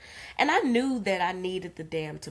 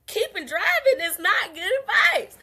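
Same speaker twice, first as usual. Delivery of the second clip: high, loud laughing protest; laughing disbelief; 13 semitones higher, 6 dB louder than usual. Retold or imitated. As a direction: crack up in disbelief at the advice. Continues exasperated laughing protest.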